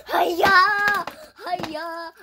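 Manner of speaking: punchy martial-arts cry; battle cry. Pretend. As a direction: shout it like a play-fight strike. Battle cry during a play fight.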